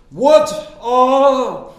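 high, slow, booming stern professor voice; loud imposing law professor, drawn out; Professor Kingsfield. Retold or imitated. Professor grilling a silent class, booming and slow. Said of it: "he really did talk like Professor Kingsfield".

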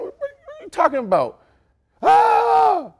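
high, loud hammed-up yell; mock screaming argument. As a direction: shout it like a mock on-air fight. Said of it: "Me and Ocho screaming".

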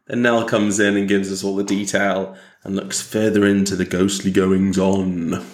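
usual speaking voice

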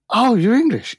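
high delighted surprise at "English"; surprised customer. Retold or imitated. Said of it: "people would come in".

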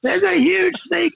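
very high, panicked cry of alarm; screaming into the phone about a snake, terrified. Retold or imitated. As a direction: shriek it in terror. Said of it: "I just screamed into the phone".

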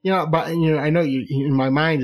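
normal talking voice

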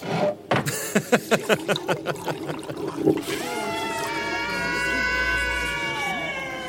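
wild high laughter, almost shouting; manic cackle. Acted. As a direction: laugh like a man unhinged. King toasts, manic laughter.

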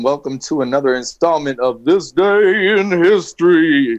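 big radio announcer voice; announcer intro. Acted. Host opening the history segment, announcer voice. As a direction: present the segment like a show host.

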